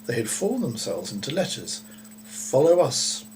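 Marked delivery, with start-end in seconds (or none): 2.2-3.2 slow, loud, strange buzzing-swarm voice; eerie slow command from the bees. A narrator's reading. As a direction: call it out slowly like a hive speaking. Bee swarm spells out words.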